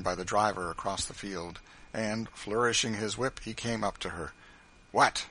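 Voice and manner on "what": loud, crowing challenge; bullying triumph; triumphant. A narrator's reading. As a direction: pounce on someone caught out. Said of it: "with an air of triumph".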